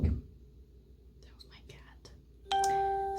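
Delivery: high, slow whispered aside. Real, not acted. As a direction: drop into a slow whisper about the cat. Aside about the cat's noise.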